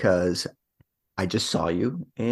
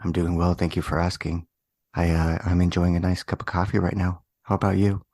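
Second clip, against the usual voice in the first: golf commentator; 3 semitones lower than usual. Pretend. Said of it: "do this whole episode like we're, like, golf commentators".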